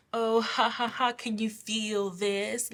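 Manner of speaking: drawn-out mock laugh and taunt. Bullies sending negative energy for fun, taunting. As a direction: imitate someone needling for fun. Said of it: "It was fun. It was some type of".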